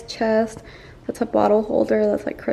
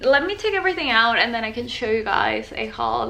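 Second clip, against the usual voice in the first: normal talking voice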